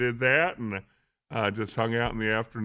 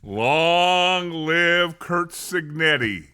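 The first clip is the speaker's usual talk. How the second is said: mock proclamation; 6 semitones higher, 4 dB louder, much slower than usual. Co-host's slow mock royal proclamation.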